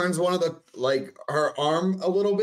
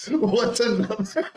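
laughing; 6 semitones higher, slower than usual. Real, not acted. Laughing echo of the joke.

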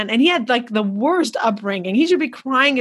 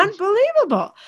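Unbelievable jumps far higher with a falling end; emphatic; 10 semitones higher than usual. Real, not acted. The host stresses Unbelievable high to agree about smiles. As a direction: hit Unbelievable high, then drop it.

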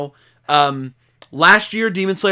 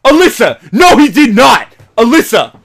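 very high, loud mock-outraged yelling; hammed-up screams of disbelief; shouted disbelief; 10 semitones higher, 11 dB louder than usual. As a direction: yell to your partner in stunned denial. Reenacting his hyped viewing reaction.

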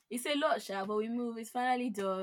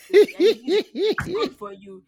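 laughing through it, high and loud; 7 semitones higher, 12 dB louder, much slower than usual. Acted. Friend laughing at the purchase, high and loud. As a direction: giggle the line out.

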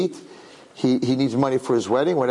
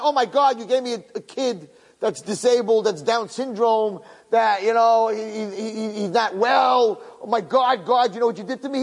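higher-pitched, imitated outraged parent; indignant complaint; 5 semitones higher than usual. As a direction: complain to God as an aggrieved parent. Imagined parent complaining to God.

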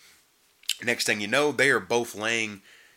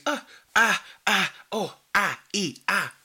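high, weak imitated struggle noises; mock feeble moans; 9 semitones higher than usual. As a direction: parody a bad actress barely whimpering. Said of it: "That type".

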